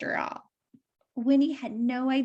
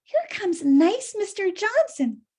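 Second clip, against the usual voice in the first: Winnie; 7 semitones higher, 4 dB louder than usual. Acted. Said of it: "said Winnie".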